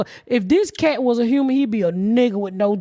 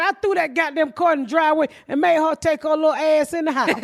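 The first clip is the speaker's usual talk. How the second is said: ordinary talk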